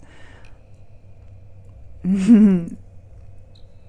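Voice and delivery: pleased, humming little laugh; contented chuckle. Acted. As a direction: chuckle with closed lips, savouring it. Savouring their treasure.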